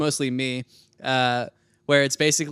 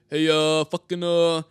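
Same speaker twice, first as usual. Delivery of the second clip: friend's Barney voice; slower than usual. Retold or imitated. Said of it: "he's like".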